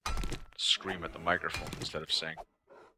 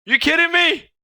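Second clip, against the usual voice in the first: much higher, much louder disbelieving yell; You kidding me? yelled far higher and louder; outraged shout; 13 dB louder than usual. Real, not acted.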